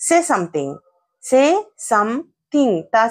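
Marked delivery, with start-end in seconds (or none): 1.3-2.7 "Say... some-thing", split apart with pauses; word-by-word demonstration. Shown as a demonstration. Teacher breaking the phrase down, word by word. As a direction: separate "Say" from "something", syllable by syllable. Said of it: "వాటిని ఎలా పలకాలి".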